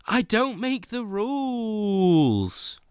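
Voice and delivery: slow, deliberate, no crack this time; steady redo. Shown as a demonstration. Streamer repeating the line to chat, slow and controlled. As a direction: say it slowly and firmly to cover the crack. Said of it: "that was an audible voice crack".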